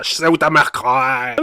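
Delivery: slow diphthong drawl; mock rapper accent. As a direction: drag the vowels slowly. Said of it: "une diphtongue comme ça".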